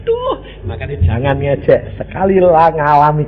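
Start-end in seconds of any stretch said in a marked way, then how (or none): 0.0-0.3 very high, wailed impression of weeping; mock childlike crying. Preacher imitating proud father crying at retreat, high childlike wail. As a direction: blubber it high like a small child. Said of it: "nangis di sana luar biasa kayak anak kecil".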